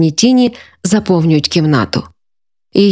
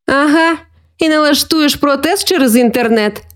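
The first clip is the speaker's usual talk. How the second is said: put-on higher boyish jibe with a grin; teasing; 7 semitones higher than usual. A narrator's reading. Brother teases sister about fixing things online, mockingly. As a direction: needle your sister playfully. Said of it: "з посмішкою спитав Микита, підколюючи".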